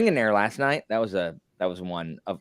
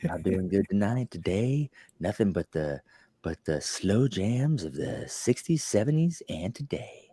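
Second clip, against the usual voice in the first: radio DJ voice; 4 dB quieter than usual. Shown as a demonstration. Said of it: "I can't be talking like this".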